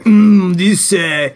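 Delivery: pompous French accent, mock-serious. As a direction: play a haughty French intellectual. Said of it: "pretend to be a French postmodernist".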